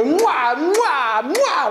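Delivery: hammed-up Italian greeting kisses; Italian cheek kisses. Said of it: "if they're Italian ... they go".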